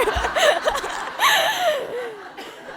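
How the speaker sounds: a short, high, giggling laugh at herself; embarrassed laugh. Real, not acted. Laughing at her own fumbled Tagalog in front of the audience. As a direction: laugh off the stumble, high and quick.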